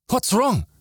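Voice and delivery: high-pitched, urgent alarm; panicked question. A narrator's reading. Marcellus shaking the speechless girl, panicked. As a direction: demand it in rising panic.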